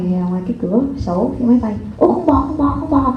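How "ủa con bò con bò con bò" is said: higher, excited mock pointing out a cow; a mother acting excited about a cow; distracting mother. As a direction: fake delight to distract a crying child. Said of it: "cổ chỉ ra ngoài".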